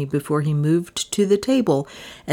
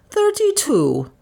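much higher, coy and arch; 12 semitones higher, slower than usual. A narrator's reading. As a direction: toss it off coyly, lying about her age. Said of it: "with an arch toss of her head".

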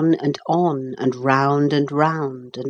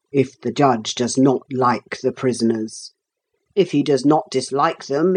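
judge passing sentence. A narrator's reading. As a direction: pronounce sentence like a stately judge. Judge passes sentence in a story.

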